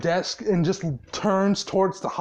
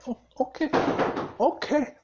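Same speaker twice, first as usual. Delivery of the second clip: higher-pitched, flustered agreement; retold nervous freak-out; 6 semitones higher, faster than usual. Pox freaking out before the angry boss, retold nervous freak-out. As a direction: imitate a panicked underling stammering assent. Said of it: "freaking the fuck out".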